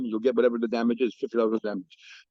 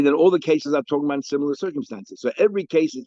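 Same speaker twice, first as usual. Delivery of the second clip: normal talking voice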